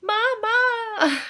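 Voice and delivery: high sing-song wail; toddlers screaming. Retold or imitated. Teacher imitates kids scared of her, wailing high. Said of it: "what they scream when they see me".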